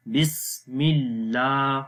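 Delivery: slow, stretching the aa in اللہ; long laam. Shown as a demonstration. Teacher showing the long vowel. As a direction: draw out اللہ gently. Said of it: "اس کو لمبا کریں گے".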